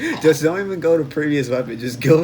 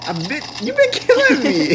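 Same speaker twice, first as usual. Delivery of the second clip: tag high protest; 12 semitones higher than usual. Real, not acted. Protests being killed by teammate.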